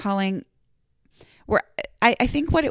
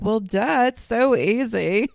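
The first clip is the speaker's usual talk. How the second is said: mocking quote; 3 semitones higher, slower than usual. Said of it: "other people are like".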